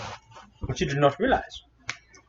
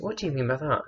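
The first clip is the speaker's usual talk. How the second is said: asked again, pitched up in confusion; 3 semitones higher, 5 dB quieter than usual. Retold or imitated. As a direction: lift it up, genuinely baffled now. Repeats the question twice.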